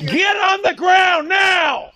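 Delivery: loud, slow, barked police command; shouting commands. Real, not acted. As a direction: yell it as an order, slow.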